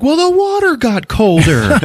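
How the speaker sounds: high-pitched indignant woman; hammed-up squabbling; mock defensive shriek. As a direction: protest in a high put-on voice. Retold bathroom quarrel, woman answers.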